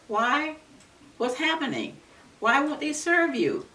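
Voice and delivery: high, puzzled customer asking why; mildly imitated puzzled customer; surprised question.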